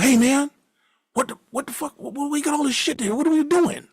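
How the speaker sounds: high, indignant mock yell; angry outburst. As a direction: blow up at the roommate in a high voice. Friend comes home to packed apartment.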